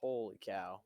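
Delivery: low, soft stunned words; hushed shock. Real, not acted. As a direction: breathe it out in dismay. Crash aftermath.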